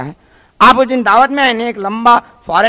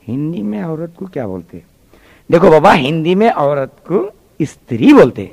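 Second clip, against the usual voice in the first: tutor voice; 4 semitones lower than usual. Acted. Tutor explains a Hindi word to the boy, lower and patient.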